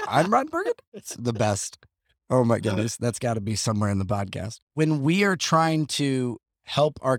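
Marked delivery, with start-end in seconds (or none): 0.1-0.8 rising, high-pitched uptick at the end of "Burgundy"; Ron Burgundy question. Acted. Co-host joking that rising ends make questions, questioning lilt. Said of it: "If it goes up at the end".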